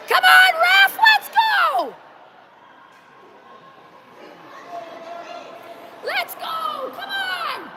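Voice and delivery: very high, drawn-out shout; yelling from the sidelines. Real, not acted. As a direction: holler at the ref from far off. Yelling at the ref.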